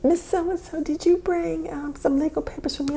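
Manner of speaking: high, lightly mocking lawyer voice; imitating the lawyer. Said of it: "and she goes".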